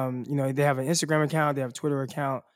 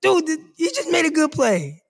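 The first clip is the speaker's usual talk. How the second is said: exasperated plea; 9 semitones higher than usual. Retold or imitated. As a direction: plead with the haters. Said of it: "And it's like".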